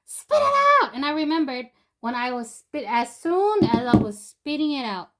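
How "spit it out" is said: high, loud shouted order; retold yell. Friend yelling at him to spit out the water, high and loud. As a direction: bark the command like a panicked friend. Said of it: "he goes".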